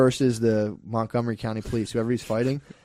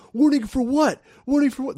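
high-pitched, louder mock indignation; indignant challenge; 13 semitones higher, 4 dB louder than usual. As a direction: throw the warning back in acted disbelief. Acted indignant reply to a warning.

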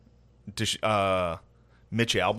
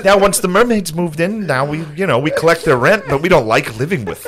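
mock complaining resident; 5 semitones higher, 5 dB louder than usual. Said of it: "They were like".

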